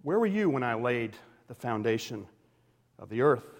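a grave divine question; God's challenge. Retold or imitated. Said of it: "God starts off by saying".

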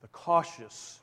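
hushed repeat. Real, not acted. Repeats quote as reflection.

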